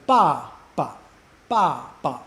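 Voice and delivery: "ba, ba" as loud falling syllable, then short neutral one; fourth then fifth tone. Shown as a demonstration. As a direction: punch the first "ba" falling, let the second "ba" drop off. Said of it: "drops off and just becomes a ba".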